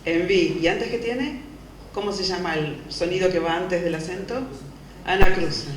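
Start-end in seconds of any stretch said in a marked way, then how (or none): 5.1-5.7 firm loud naming of the term; teacher stating the answer emphatically; loud call. Real, not acted. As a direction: announce the word to the class. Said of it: "teacher calls out term".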